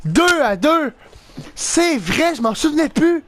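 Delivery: high-pitched, thrilled realisation; elated. Real, not acted. Player just tied it two to two, elated. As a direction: still buzzing from the goal. Realising after tying goal.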